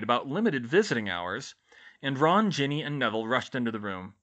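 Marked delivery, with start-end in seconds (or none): none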